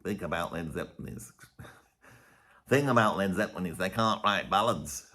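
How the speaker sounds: low-pitched mock northern accent; generic northern English accent. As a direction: badly imitate a Liverpudlian, landing vaguely northern. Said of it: "a generic northern accent".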